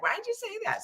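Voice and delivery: high, small mock complaint; put-on high, soft quote; mocking partner voice. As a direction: imitate a partner's objection in a squeaky little voice. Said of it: "I'll say it in that voice too".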